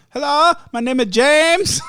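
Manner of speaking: high, loud mock Vietnamese accent; hammed-up singsong Vietnamese-accented greeting; James. Phone agent introduces herself to caller, mock Vietnamese accent. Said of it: "That's my impersonation of James".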